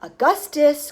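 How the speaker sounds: loud, urgent call of a name; crying out. A narrator's reading. Wonka to the boy at the river, crying out. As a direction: call out sharply to stop him. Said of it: "cried Mister Wonka".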